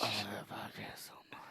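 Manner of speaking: whispered echo of the line. Pretend. Echoing his co-host in a hushed whisper. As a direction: breathe it back as a whisper.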